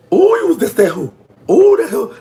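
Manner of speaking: real delight at placing her; squealing oh, that hoe; excited recognition. Real, not acted.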